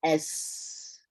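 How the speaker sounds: a hissed, voiceless "S" with breath flowing; unvoiced "S", all breath; whispered letter. Shown as a demonstration. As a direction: let the air stream out on "S". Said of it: "So when I say F".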